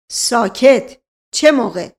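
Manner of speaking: loud cutting-off command; punched, overbearing police question; bullying interrogator. Acted. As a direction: silence him and demand the answer. Inspector cuts servant off.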